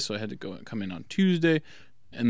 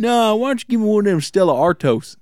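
Dude impression; 10 semitones higher, 8 dB louder than usual. Retelling the beer ad to a friend, hammed-up shouted order. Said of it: "He's like".

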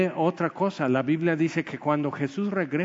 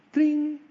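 high sparkle sound; 11 semitones higher than usual. Retold or imitated. Fairy wand sparkle sound.

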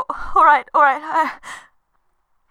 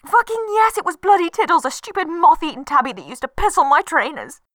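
angry outburst; 6 semitones higher, 3 dB louder than usual. Acted. Furious answer to automated voice.

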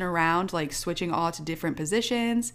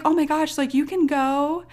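high-pitched imitation of concerned strangers; concerned ladies; 9 semitones higher than usual. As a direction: voice kindly women waving someone ahead. Voices concerned ladies in line.

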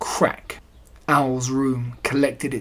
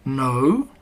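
mumbly. A narrator's reading. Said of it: "said Pooh mumbly".

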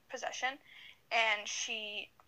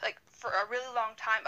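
usual speaking voice

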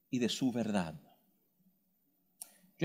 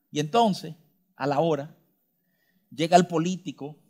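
usual speaking voice

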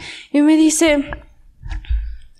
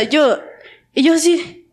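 ordinary delivery

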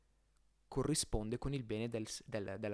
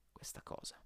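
whispered aside; 13 dB quieter than usual. Real, not acted. Aside contradicting earlier claim.

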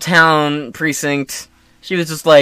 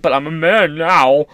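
lightly caricatured strained declaration; weird put-on voice; 4 dB louder than usual. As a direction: stretch it out in an odd mock voice. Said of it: "in that weird voice".

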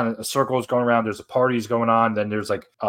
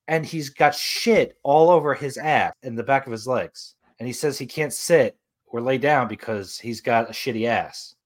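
ordinary talk

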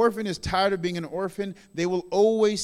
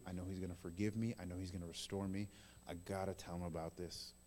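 very low, soft inner voice; hushed confiding; 13 semitones lower, 16 dB quieter, faster than usual. Acted. Child resolving to tell dad, hushed and confiding. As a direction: think it aloud, barely voiced.

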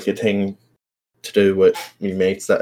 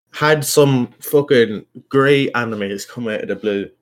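ordinary talk, nothing marked

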